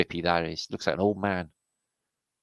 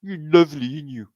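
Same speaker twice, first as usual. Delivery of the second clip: cooing gorilla mum; 7 semitones higher, 6 dB louder, faster than usual. Pretend. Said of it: "She's like".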